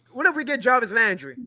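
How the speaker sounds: ordinary delivery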